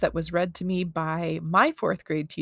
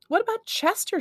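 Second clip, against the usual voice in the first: put-on high, eager boy voice; eager young questioner; kid blurting out. A narrator's reading. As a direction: pipe up much higher.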